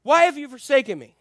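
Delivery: loud, high anguished cry of Jesus; Jesus crying out from the cross; screamed cry. Retold or imitated. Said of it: "He screamed".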